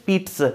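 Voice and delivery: pizza said "peet-suh", with a ts sound; correct pronunciation. Shown as a demonstration. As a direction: put a t before the s in pizza. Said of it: "this one is the correct pronunciation".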